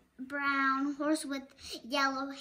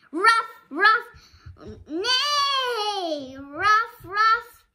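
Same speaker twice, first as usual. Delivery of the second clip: much higher, louder yappy barks with a whinny; barking and neighing; 8 semitones higher, 10 dB louder, much slower than usual. Acted. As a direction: bark like a small dog, then neigh. Dog barking, horse neighing.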